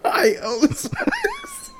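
squeaky, wheezing through laughter; laughing. Real, not acted. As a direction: barely get it out while laughing.

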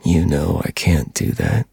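low, slow gravelly menace; dark whisper behind you. A narrator's reading. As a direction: growl it from the shadows. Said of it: "deep, distorted, and gravelly".

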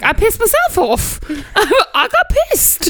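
high, laughing insistence; mock exasperation. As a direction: protest squeakily through laughter. Retold exasperated misunderstanding.

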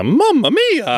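high, slow stretched Italian Mario exclamation. Retold or imitated. Cohost chiming in with a high Mario Mamma Mia. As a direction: belt it out like the cartoon plumber. Joking about Mario catchphrases.

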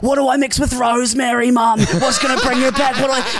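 high, frantic mock plea to mum; fake panic. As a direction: pretend a real emergency over the phone. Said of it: "And I'm like".

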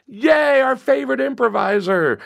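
hammed-up high-pitched fan excitement; cheering audience. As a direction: imitate fans whooping for their favorite. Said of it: "The audience is like".

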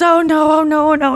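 high, loud dismayed oh no; hammed-up mortified groan; mock dismay. Rachel caught by Ross, high and mortified. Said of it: "She goes".

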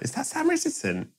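high, soft gasp of a fan; starstruck fan. Pretend. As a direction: breathe it out like you just spotted a celebrity. Fans spotting celebrity in mall.

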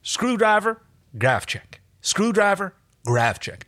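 screwdriver pitched high, Grafchek at normal pitch; contrasting repetition. Acted. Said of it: "John repeated into her fingers".

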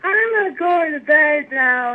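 high, loud, slow mock sleepy whine; sleepy hint. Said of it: "I'm like".

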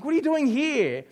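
dad seeing nails hammered into the fort, loud alarm. Retold or imitated. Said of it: "I'm like".

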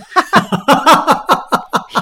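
bursting into high laughter; big delighted laugh; loud laugh. Real, not acted. Guest laughs at own joke.

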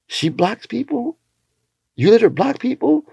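high, slow and stretched, hardly believing it; shocked disbelief. Retold or imitated. Buddy asks him in disbelief, high and drawn out. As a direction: sound stunned that she blocks people.

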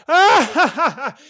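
loud, high imitated belly laugh; big burst of laughter. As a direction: burst out in mock uproarious laughter. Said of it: "He bursts out into the biggest laugh".

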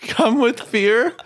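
laughing through the words, high. Real, not acted. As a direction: repeat it while cracking up. Echoes own phrase, laughing.

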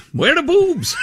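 high hammed-up shout of outrage; mock shout. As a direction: yell it in mock indignation. Mock clickbait reader outrage.

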